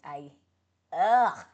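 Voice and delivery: ordinary delivery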